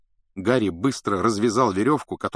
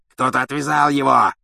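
loud, raised snarl of rage; furious growl; 5 semitones higher, 7 dB louder than usual. A narrator's reading. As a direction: snarl an angry accusation. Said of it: "рычал палач".

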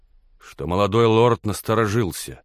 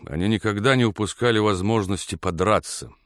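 ordinary delivery, nothing marked

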